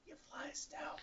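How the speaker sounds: hushed whisper, leaning in. Retold or imitated. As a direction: whisper it close to his ear. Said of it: "he'd whisper".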